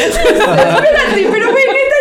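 high, laugh-broken words; real laughter while protesting; laughing hard. Real, not acted. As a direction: talk through real laughter. Cracking up at the story.

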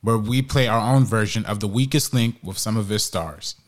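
loud, punchy promo read. Acted. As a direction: sell the live stream like an ad. Promo read between segments.

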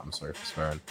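quietly contrite aside; soft retold apology. Speaker apologises to the young kids, soft retold apology. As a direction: say sorry softly, voice dropped.

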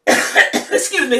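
normal talking voice

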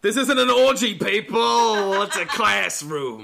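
loud, high principal's rant; Snyder quote. Retold or imitated. Quoting Snyder to friends, mock-outraged loud principal. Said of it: "a good Snyder quote".

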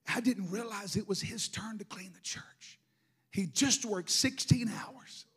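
whispering the memory of his tired dad; hushed. Real, not acted. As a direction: drop to a whisper in awe. Hushed recalling father cleaning church.

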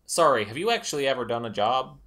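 quick, loud sarcastic question; sarcastic jab. Acted. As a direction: fire it off mockingly. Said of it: "and Sarah says".

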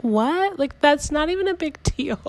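high, rising disbelieving "What?"; stunned that he'd refuse; incredulous what. Retold or imitated. Reacting in her head to her husband, high disbelief.

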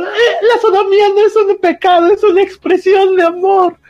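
exaggerated sobbing defense of love; mock crying plea. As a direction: plead theatrically through fake tears. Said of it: "y llorando".

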